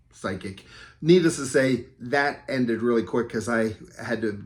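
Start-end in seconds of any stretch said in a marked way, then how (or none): 0.1-0.6 whispered jab. Retold or imitated. Mocking the psychic at the show, whispered.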